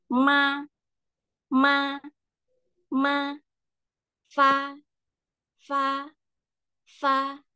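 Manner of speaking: "ma" and "fa" high, level, held; first-tone drill. Shown as a demonstration. Teacher drilling M and F syllables, high level tone. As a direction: repeat each on one high note. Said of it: "Kalau nada satu".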